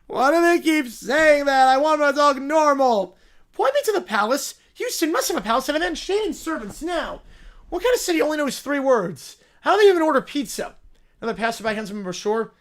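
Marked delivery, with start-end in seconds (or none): 0.1-10.7 higher, louder bratty whine; whiny spoiled royal. Acted. Spoiled character complains about the flight, whining. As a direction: whine like a pampered little royal who hates travel.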